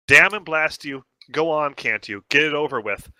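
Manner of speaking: normal talking voice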